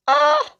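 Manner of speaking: sudden high yelp of shock; a sharp startled squeal; a burst of disbelief at the numbers; shocked cry. Real, not acted. Shock at revenue.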